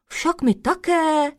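high, drawn-out chiming in; calling out. A narrator's reading. The other sprites eagerly join in, calling out. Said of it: "volali ostatní".

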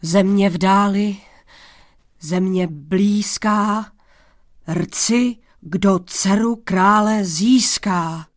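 hlasité táhlé volání; zoufalý výkřik. A narrator's reading. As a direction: zoufale volej přes jezero na ozvěnu. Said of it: "a vzkřikl zoufale". (zoufalé volání do dálky)

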